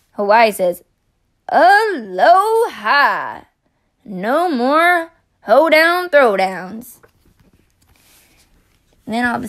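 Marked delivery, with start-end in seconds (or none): 1.5-7.0 slow, loud drawn-out greeting; relieved aloha. Acted. Hawaii relieved to be home, slow and loud. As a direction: stretch the aloha out happily.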